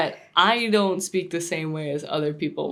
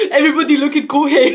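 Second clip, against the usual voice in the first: high, loud mock French accent; hammed-up French accent, fast and loud; French man impression; 9 semitones higher, 10 dB louder, faster than usual. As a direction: imitate an excited Frenchman, laughing. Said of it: "The French man".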